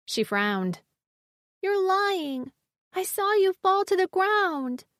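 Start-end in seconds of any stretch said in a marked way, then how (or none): 1.6-4.9 put-on high little girl voice, doubtful; a child refusing to believe it. A narrator's reading. Riven the child protests.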